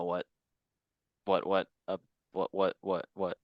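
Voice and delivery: stuttering on what, stuck repeating. Real, not acted. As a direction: trip over the words, repeating what. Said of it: "That was a stutter".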